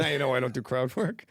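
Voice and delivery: lightly voiced comic's aside; a hint of impersonation on a dry excuse; Colin Quinn. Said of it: "Said that like Colin Quinn".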